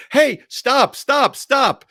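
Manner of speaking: high, loud, waving down a police car; loud high cries of stop; frantic shout. Retold or imitated. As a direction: flag them down, yelling.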